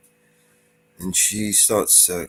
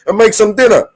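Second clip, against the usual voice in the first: loud, mock bossy order; husband ordering his wife to the kitchen, loud and mock bossy; gruff husband command; 12 semitones higher, 10 dB louder than usual. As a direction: bark the order like a fed-up husband. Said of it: "He says".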